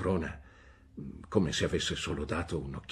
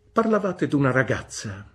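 high, loud, brusque "Bene?"; stern old aunt; 9 semitones higher, 9 dB louder than usual. A narrator's reading. Aunt Betsy demanding news from the mother, brusque. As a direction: demand an answer like a sharp old lady.

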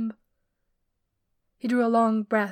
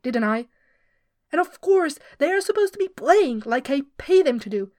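indignant rant; 2 semitones higher, 4 dB louder than usual. Acted. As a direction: vent the whole grievance at Finrod. Turgon ranting to Finrod.

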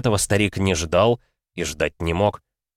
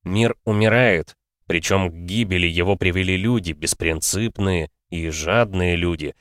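ordinary delivery, nothing marked

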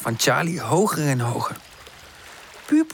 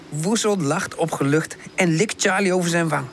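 plain reading voice

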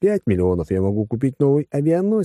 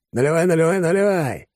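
higher, lively urging to pour; perked up; 8 semitones higher than usual. A narrator's reading. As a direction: rattle it out eagerly, pitch up. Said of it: "Алекс и оживился". (оживлённо, высоким голосом)

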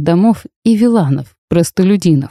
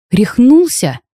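outraged; 6 semitones higher, slower than usual. A narrator's reading. After a slap in the face.

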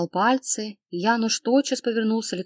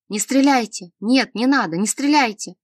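loud desperate shout; 4 semitones higher, 6 dB louder than usual. Acted. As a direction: yell to stop them shooting. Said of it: "крикнул он".

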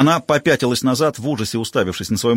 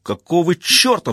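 higher, slow roar; 7 semitones higher, slower than usual. A narrator's reading. Rollo roaring as he is cuffed, angry. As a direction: roar it out in anger. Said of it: "взревел Ролло".

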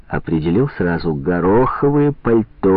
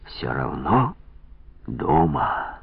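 tender murmur; 6 semitones lower, 7 dB quieter, much slower than usual. A narrator's reading. Quiet inner joy.

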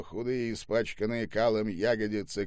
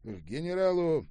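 usual speaking voice

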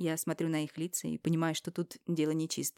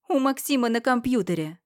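higher, louder public declaration; loud, for all to hear; 6 semitones higher, 7 dB louder than usual. Acted. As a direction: announce it to everyone on deck. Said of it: "Громко, во всеуслышание говорю". (громко, во всеуслышание)

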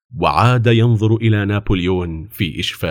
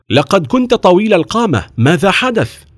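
higher-pitched, surprised questioning; puzzled officer; 9 semitones higher, 4 dB louder than usual. A narrator's reading. Officer questioning the suddenly shorter boy, puzzled. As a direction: question him in raised surprise.

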